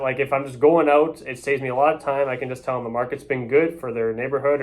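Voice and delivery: usual speaking voice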